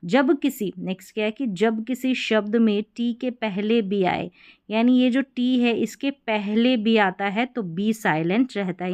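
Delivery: normal talking voice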